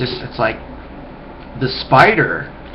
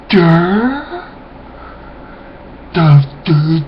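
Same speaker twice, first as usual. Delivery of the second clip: dopey grunts; 5 semitones higher, much slower than usual. Retold or imitated. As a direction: ham up a dim-witted grunt. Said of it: "instead of just going".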